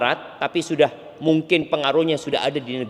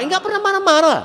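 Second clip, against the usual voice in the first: much higher, louder emphatic repeat; 14 semitones higher, 4 dB louder than usual. Shown as a demonstration. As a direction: leap up high to drive the point home. Preacher repeats phrase for emphasis.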